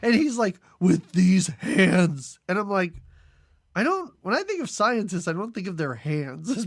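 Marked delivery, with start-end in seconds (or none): 0.8-2.4 loud, very slow and weighty; mock-dramatic protest. Swamp man objects to returning to science, hammed-up protest. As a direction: stretch each word out in hammed-up despair.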